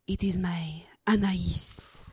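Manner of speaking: depressed French vampire. Shown as a demonstration. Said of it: "French vampire".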